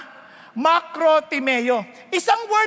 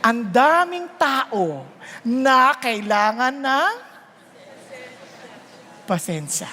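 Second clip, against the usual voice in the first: slow, drawn out; 4 semitones lower, much slower than usual. Real, not acted. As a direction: stretch each word out, then drop to a hush. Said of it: "slow preaching on patience".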